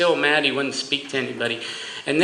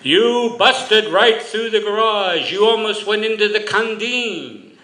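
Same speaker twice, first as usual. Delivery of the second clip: Italian grandfather voice, much higher and louder; thick Italian accent; 8 semitones higher, 4 dB louder than usual. Retold or imitated. Son imitating his Italian grandfather teasing his dad, in a thick Italian accent. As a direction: tease him in a heavy, amused Italian accent. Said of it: "you hear this thick Italian accent".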